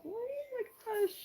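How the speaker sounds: high, thrilled squeal; sudden high-pitched gasp of joy; excited squeal. Real, not acted. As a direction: squeal with delight at the present. Squeals over a gift.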